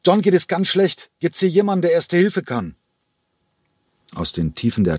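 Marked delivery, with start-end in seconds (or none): 0.0-2.8 high, loud call through the cabin; urgent shout to the whole plane. Acted. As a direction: shout it down the aisle to wake everyone. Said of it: "rief lautstark durch den Flieger".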